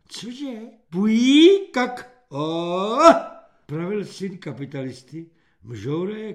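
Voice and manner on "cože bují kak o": babble. A narrator's reading. Simpleton babbling.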